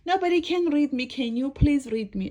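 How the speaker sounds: high, loud plea; hammed-up desperate appeal; desperate storybook book. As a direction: beg loudly as the distressed little book. Book begs the dog.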